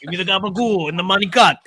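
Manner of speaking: mobster wiseguy voice; New York Italian accent. Retold or imitated. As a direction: talk like a Jersey gangster.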